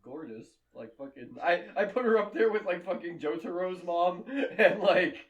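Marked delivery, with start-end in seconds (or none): 1.4-5.2 laughing. Real, not acted. Gushing about hot moms.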